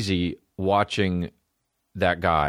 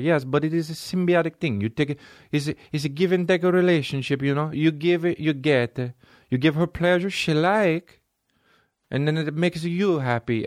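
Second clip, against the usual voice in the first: higher, hammed Italian accent; 7 semitones higher than usual. The Italian explains give and take, Italian accent. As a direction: pitch up and gush like an Italian. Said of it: "Italians go like".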